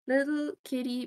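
sing-song to the kitty; pet voice. Real, not acted.

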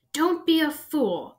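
high, slow snarl. A narrator's reading. Voldemort's face snarling at Harry not to run. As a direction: snarl it as a warning. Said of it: "snarled the face".

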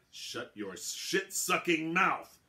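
very slow, loud snarling fury; furious. Acted. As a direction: spit each word out in rage. Gnome lunges with dagger.

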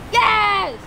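high, very loud drawn-out cheer; triumphant yell. Real, not acted. Player celebrating the teammate's goal, triumphant yell. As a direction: scream it in triumph.

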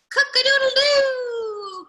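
high, loud, drawn-out crow; rooster crow. A narrator's reading. Reader voices Rooster heading to the farmhouse, crowing. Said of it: "crowed Rooster".

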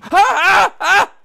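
very high, loud scream. Real, not acted. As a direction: shriek in fright. Scream at enemy.

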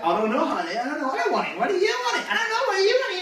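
hammed-up sweet indecision, spiralling upward; annoying dithering couple. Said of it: "He's like".

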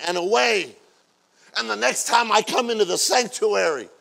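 loud, rising sermon cadence with shouts; preaching at full force. Acted. Pastor rousing the church about forgiveness, loud preaching. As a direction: build the congregation up to praise.

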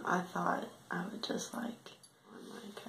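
hushed unvoiced whisper. Real, not acted. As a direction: whisper it so no one hears. Quiet whisper on the toilet.

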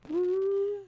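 high, bright whoop of joy; delighted whoop at fireworks; excited cheer. Acted. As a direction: let out a celebratory woo.

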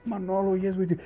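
high, loud imitation of gushing guests; eager reassurance. Diners reassuring the manager, effusive. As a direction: act out diners insisting they loved it.

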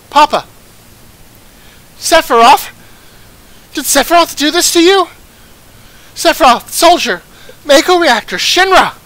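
high, loud cries of grief and rage; anguished fury. Acted. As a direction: build from shocked cry to furious scream. Daughter finds her father slain.

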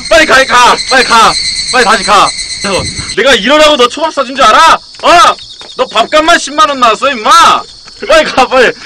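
loud, high nagging yell; exasperated scolding. Real, not acted. Streamer scolding the friend he bought sushi for, loud nag. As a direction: chew him out for wasting dinner money.

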